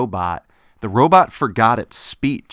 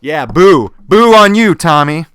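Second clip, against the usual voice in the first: big jeering boo, higher and louder; 5 semitones higher, 12 dB louder than usual. Acted. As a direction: shout the boo out gleefully. Robot booing Tommy in game.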